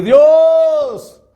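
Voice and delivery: full-voiced wail, very high and sustained; screaming to God. Man on his knees begging God's forgiveness, screaming. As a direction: cry upward, stretching each word into a howl. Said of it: "grité hacia arriba y le dije".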